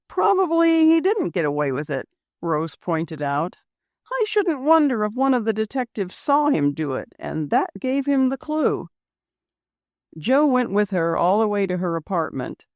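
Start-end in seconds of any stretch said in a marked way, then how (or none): 0.1-2.1 Rose's voice. Acted. Rose voiced by narrator. 4.1-8.9 Rose's voice. Acted. Rose voiced by narrator.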